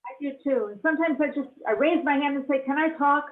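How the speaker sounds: usual speaking voice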